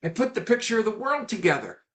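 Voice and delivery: put-on high, bubbly little boy voice; excited child. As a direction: burst with pride like a small boy. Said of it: "he's all excited".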